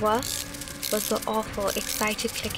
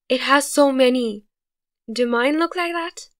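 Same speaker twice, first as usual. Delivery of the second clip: hysterical; 3 semitones higher, 6 dB louder than usual. A narrator's reading. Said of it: "he said hysterically".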